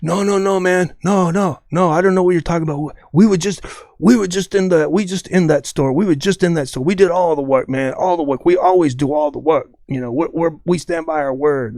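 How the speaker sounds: put-on voice, repetitive protesting wise guy; cigar-chomping company guy. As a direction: insist and deny, talking round a big cigar. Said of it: "he's got a big cigar in his mouth".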